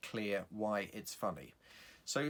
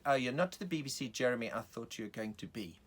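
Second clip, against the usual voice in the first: South African woman in the audience; lady with a South African accent. Acted. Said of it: "a woman with a South African accent".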